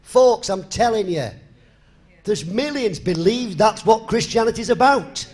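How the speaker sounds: preaching. Acted. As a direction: drive it home to the congregation. Preaches to the folks.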